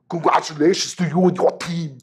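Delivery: put-on high, loud, clumsy Austrian accent; Schwarzenegger impression. Engineer imitating the governor's Austrian accent, hammed-up. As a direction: do a bad Arnold Austrian accent. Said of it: "accent's not so great".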